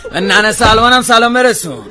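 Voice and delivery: loud, high call after someone. Acted. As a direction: shout a parting message across the yard. Calling out after the departing boy. (با صدای بلند و زیر صدا زدن)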